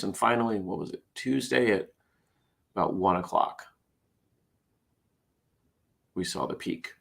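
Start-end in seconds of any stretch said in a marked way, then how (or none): none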